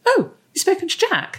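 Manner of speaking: high-pitched retold surprise; mock surprise. Mum hearing her son has been in touch, mock surprise. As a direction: leap up high on an astonished question. Retold reaction to son's call.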